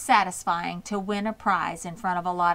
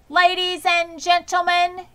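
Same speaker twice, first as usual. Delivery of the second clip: higher, louder, booming over a loudspeaker; big public-address voice; loudspeaker announcer; 10 semitones higher, 6 dB louder than usual. A narrator's reading. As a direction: announce it to the whole fairground. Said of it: "continued the loudspeaker".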